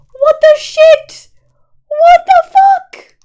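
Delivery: very high, loud, slow shriek; shrieking outrage. Real, not acted. Streamer shrieking at the burning base. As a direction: stretch out a furious shriek.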